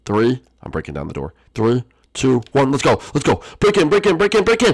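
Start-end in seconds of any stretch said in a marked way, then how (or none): 3.6-4.7 high, loud repeated battle cry; frantic charge. Pretend. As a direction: shout it urgently while storming in. Storming through the door.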